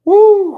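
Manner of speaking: loud high whoop; whooping yell. Real, not acted. Streamer whooping to wake herself up, loud and high. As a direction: let out a big happy whoop.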